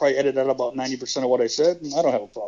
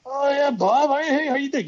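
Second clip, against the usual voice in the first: much higher, cheery greeting voice; Terry Taylor greeting; 12 semitones higher than usual. Retold or imitated. Terry greeting Bob after twenty years, much higher delighted voice. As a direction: squeal out a delighted hello. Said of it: "He goes".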